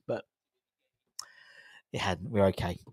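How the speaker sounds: ordinary delivery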